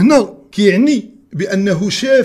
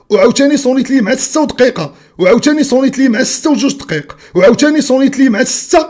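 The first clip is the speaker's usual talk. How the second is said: furious shouting rant; 5 semitones higher, 8 dB louder than usual. Real, not acted. Ranting at rival about alarms.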